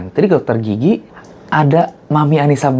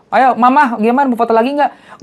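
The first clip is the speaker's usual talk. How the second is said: friendly star; 10 semitones higher than usual. Retold or imitated. Jupe inviting mother to pose.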